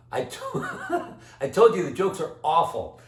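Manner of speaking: laughing through the words; chuckling at his own bad joke. Real, not acted.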